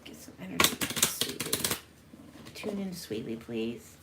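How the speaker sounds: hushed, breathy call to the energy; soft murmured summoning over the cards; ritual whisper. Real, not acted. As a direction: whisper it like an invocation. Calling on energy during card reading.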